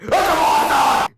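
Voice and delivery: high, slow, loud terrified scream. Acted. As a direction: shriek in panic. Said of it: "The flying principal screamed".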